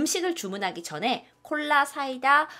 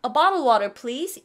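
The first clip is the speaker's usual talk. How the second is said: ordering politely; 3 semitones higher, 3 dB louder, much slower than usual. Shown as a demonstration. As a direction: play a customer asking the waiter. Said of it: "이런 식으로 하시면 돼요". (식당 손님처럼 공손하게 주문)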